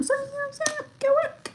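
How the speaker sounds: high cartoonish voice; squeaky high joke voice. Vlogger to viewers, squeaky high mock voice.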